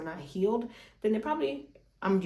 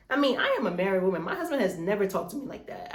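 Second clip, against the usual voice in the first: ordinary delivery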